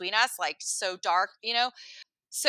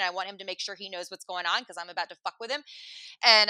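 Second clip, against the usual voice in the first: normal talking voice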